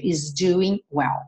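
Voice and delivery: is doing well said slowly; slow model phrase. Shown as a demonstration. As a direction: say it slowly for learners. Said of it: "slow model phrase".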